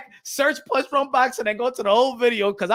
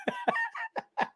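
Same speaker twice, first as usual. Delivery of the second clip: a sudden squeal of laughter, much higher; high burst of laughter; 11 dB quieter than usual. Real, not acted. Laughs at fanboy comment.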